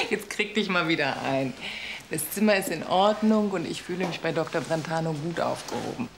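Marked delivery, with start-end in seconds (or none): none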